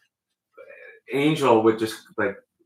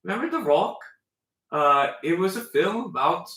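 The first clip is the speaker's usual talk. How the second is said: put-on mid-Atlantic accent; 7 semitones higher than usual. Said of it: "mid-Atlantic accent".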